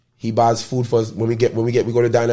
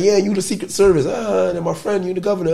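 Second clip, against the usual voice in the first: much higher, joking grand announcement; playful high mock; 11 semitones higher, 4 dB louder than usual. He jokes back naming friends Secret Service, high playful. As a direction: crown your friends in a high silly voice.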